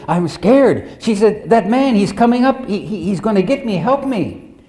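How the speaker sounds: high, loud, fast panicked plea, imitating the patient; retold fear. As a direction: cry for help as if a man is coming at you. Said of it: "and instantly she became very".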